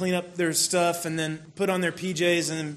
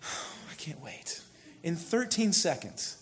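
weary inner sigh; 7 dB quieter, slower than usual. Retold or imitated. Dad's inner thought at bedtime.

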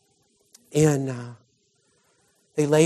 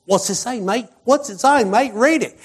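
put-on high, eager British accent; British crowd; 8 semitones higher than usual. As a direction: call out like an impatient Brit in a crowd. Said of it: "they were all Brits".

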